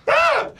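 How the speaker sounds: short high-pitched yelp of pain; girlish scream. Retold or imitated. Teller slapped on his fresh tattoo, imitated girl scream. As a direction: imitate a girly shriek from a stinging slap. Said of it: "girl scream into tears".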